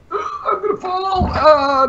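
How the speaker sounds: gasping, high startled cry; real alarm at slipping; sudden fright. Real, not acted. Slipping while turning off fan.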